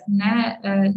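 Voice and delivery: ordinary talk, nothing marked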